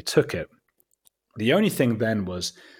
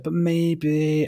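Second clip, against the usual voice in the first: higher, drawn-out hopeful lilt; coy retold maybe; 8 semitones higher, 4 dB louder than usual. Executive dangles hope to filmmaker, teasing high lilt. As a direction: dangle the possibility in a raised voice.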